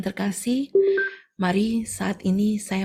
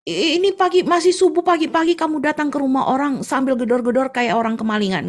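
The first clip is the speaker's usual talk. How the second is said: high, louder scolding; irritated complaint; 5 semitones higher, 4 dB louder than usual. Acted. Tofu seller scolding the neighbour, raised irritated voice. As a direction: scold her for banging so early. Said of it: "Dia bilang gitu ya".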